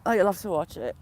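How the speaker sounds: ordinary talk, nothing marked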